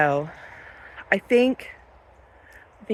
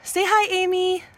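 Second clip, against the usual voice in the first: high, cooing voice for an animal; pet talk; 10 semitones higher than usual. Real, not acted. Farmer asking her goat to greet viewers, high cooing pet talk. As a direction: coax the goat to say hello, sing-song.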